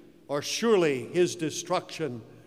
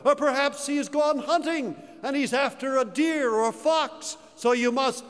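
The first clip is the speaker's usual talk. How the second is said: mocking taunt; 6 semitones higher than usual. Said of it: "as Elijah mocked them".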